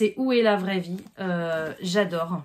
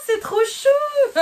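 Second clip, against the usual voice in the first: high, slow squeal of delight; cute squeal; 6 dB louder, much slower than usual. Real, not acted. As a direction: gush over something adorable. Unboxing a cute gift, squealing. (cri aigu attendri, lent)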